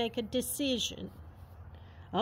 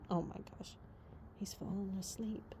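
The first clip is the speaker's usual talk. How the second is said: soft unvoiced whisper; 4 semitones lower, 14 dB quieter than usual. Real, not acted. Owner watching her horse fall asleep, whispering. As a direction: breathe it out barely audible.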